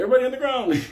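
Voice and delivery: high mock guard's yell; shouted order. Prison guard ordering yard down, mock shout.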